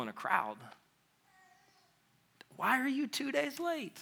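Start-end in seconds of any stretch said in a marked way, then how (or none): 2.6-4.0 high, pained accusing voice; a grieving sister confronting her late friend; hurt sister. Acted. Grieving Martha asks Jesus why he came late.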